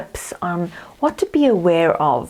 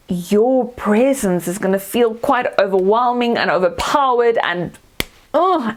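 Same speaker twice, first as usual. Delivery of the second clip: ordinary talk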